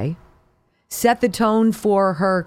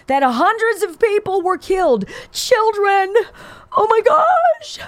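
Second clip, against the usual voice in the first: climbing higher into a shrill mock shout; mock hysteria; 12 semitones higher than usual. Host parodying a congresswoman's hospital claim, rising to a hammed-up mock shout. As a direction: ham up a panicked outcry.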